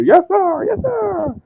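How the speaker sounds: very high squealed reply; orcs answering. Acted. Orcs outside answer their master, high-pitched eager squeal. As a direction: squeal it eagerly like an obedient minion. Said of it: "from outside you hear".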